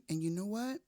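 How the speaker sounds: usual speaking voice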